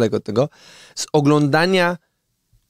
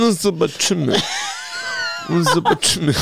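skeptical deep voice; 6 semitones higher than usual. Acted. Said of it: "Fajny głos".

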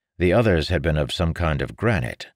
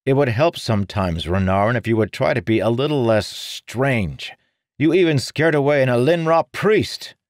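louder, exasperated scolding; annoyed; 8 semitones higher, 5 dB louder than usual. Acted. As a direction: scold him in frustration. Marin scolds brother.

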